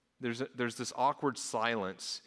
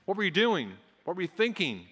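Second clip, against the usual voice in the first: sharp, louder scolding questions; scolding like a parent; 5 semitones higher, 3 dB louder than usual. Retold or imitated. Guests scolding Mary for wasting the ointment, louder scolding. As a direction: scold her like a foolish child. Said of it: "to scold her. It's like what a parent might do".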